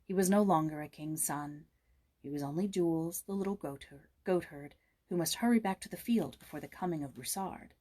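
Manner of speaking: plain reading voice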